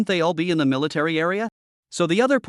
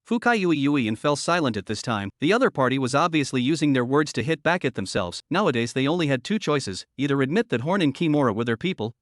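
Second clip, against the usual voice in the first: plain reading voice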